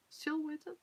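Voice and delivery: "Silhouetted?" asked high and rising, testing the sound; puzzled attempt at "Silhouetted"; unsure try at a word. Shown as a demonstration. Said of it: "I don't know how to say that word".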